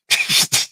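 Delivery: unvoiced giggle burst; breathy laugh. Real, not acted. Streamer laughing while retelling the phone call.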